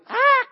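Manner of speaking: short, high parrot screech; loud parrot squawk. Acted. Parrot spots a woman in the pet store, squawks very loudly. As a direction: let out one loud, piercing squawk. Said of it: "said very loudly".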